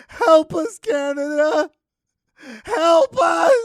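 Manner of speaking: very high, shouted fake cry; mock sobbing plea. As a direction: beg at full wail for rescue. Pretend refugee begging for rescue.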